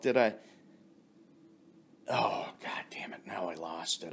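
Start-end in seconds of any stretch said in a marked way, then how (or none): 2.1-4.1 whispered to himself after losing his place; frustrated whisper. Real, not acted. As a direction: mutter it under your breath, annoyed. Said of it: "loses his train of thought".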